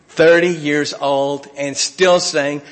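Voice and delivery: ordinary talk, nothing marked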